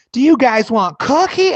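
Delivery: high, loud mom voice; mock cheery mother. Mother walks in offering snacks, high mock mom voice. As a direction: squeal it like an oblivious mom.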